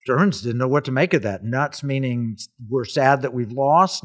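ordinary talk, nothing marked

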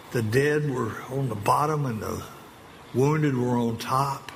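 usual speaking voice

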